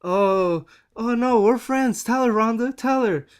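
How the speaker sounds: squeaky fake-friendly backpedalling; Stephanie imitation. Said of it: "Stephanie tried to say".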